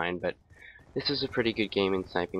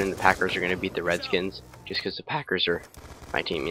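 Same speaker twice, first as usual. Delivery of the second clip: ordinary delivery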